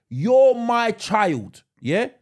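loud, high, drawn-out insistence; stern father. Acted. Dad telling his would-be rebellious kid, loud emphatic sternness. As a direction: lay down the law, stretching each word. Father laying down law to child.

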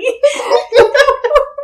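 high helpless laugh; cracking up. Real, not acted. Laughing fit continues.

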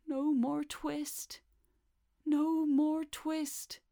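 high, drawn-out moan from sleep; very slow, high feverish refrain; dreaming mumble. A narrator's reading. Feverish tailor talking in his dreams, slow high moaning. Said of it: "still in his dreams he mumbled".

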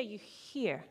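quiet meaningful "hear"; stressed hear. Shown as a demonstration. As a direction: lean into "hear" as real listening. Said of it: "So you don't just hear".

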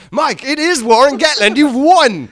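high, loud mock game-show thrill; acted excited announcement. Comic imagining being told the guess was right, excited. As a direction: announce the winner with hammed-up excitement.

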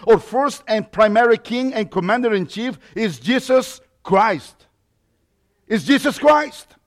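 loud, driving sermon delivery; preaching at full force. Acted. Pastor urging the church not to settle, forceful preaching. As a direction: hammer each point home to the congregation.

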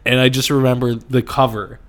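ordinary talk

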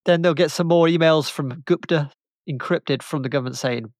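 normal talking voice

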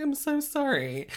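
very high, slow retold apology; apologetic. Shop worker apologising to a star, high imitated apology. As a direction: mimic a squeaky, pained sorry. Apologising to [name] over missing shoes.

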